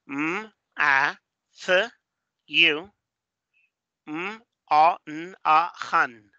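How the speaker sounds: M, A, C, U as separate sounds with pauses; sounding out. Shown as a demonstration. Teacher decodes a name from colours, sounding it out piece by piece. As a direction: sound out each piece separately, ending on HAN. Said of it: "los colores identificados de los sonidos".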